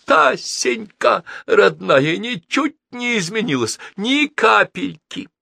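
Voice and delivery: high, slow joyful greeting; an old man embracing his sister; high, drawn-out happy exclamation; cry of joy. A narrator's reading. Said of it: "Вскрикнув от радости".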